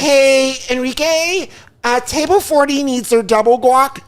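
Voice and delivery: the waitress ordering from the busser sweetly; mock prissy waitress call. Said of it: "That were like".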